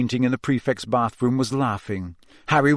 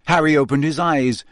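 plain reading voice

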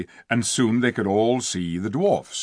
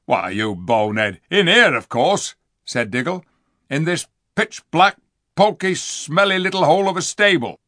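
louder, higher rough dwarf scorn; scornful dwarf; 6 semitones higher, 6 dB louder than usual. Acted. Diggle scolding Edmund about the dark stable, loud and scornful. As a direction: spit out the insults.

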